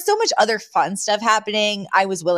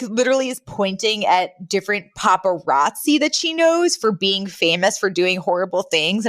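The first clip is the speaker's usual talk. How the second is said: ordinary delivery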